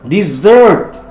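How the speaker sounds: dessert with stress on zert, di-ZERT. Shown as a demonstration. Teacher drills dessert, stressing zert. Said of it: "Ini yang ditekan zert ya".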